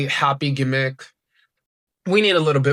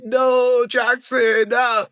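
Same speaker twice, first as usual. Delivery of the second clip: high, drawn-out pretend wail; mock crying; 7 semitones higher, much slower than usual. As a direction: imitate a tearful plea, pitched well up. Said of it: "Zeta starts crying".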